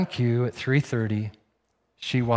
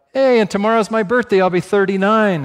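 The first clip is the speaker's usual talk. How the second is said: higher, louder retold announcement; announcing loudly; 7 semitones higher, 6 dB louder than usual. Woman tells diner companions about her birthday, retold loud announcement. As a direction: act out calling it out to the others.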